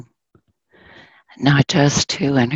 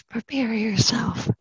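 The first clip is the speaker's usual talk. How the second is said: soft whispered warning, higher; hushed like telling a scary secret; dramatic whisper; 7 semitones higher, 7 dB quieter than usual. Acted. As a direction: whisper it to brace the listeners. Storyteller warns of sad turn.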